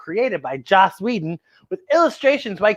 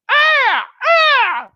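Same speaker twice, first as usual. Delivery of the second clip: high, loud screeching bird cry; vulture screech; 5 dB louder than usual. Shown as a demonstration. Host showing kids a vulture sound, loud high screech. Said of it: "Can you do a vulture sound".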